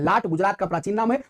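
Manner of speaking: normal talking voice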